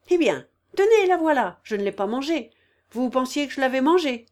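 high, loud, indignant retort; exasperated outburst. A narrator's reading. Woman hauls her before the sick man, exasperated outburst. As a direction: start high and loud, scolding.

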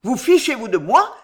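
angry pharmacist crying out; furious shout. A narrator's reading. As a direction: yell at careless workmen in outrage. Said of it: "cria le pharmacien furieux".

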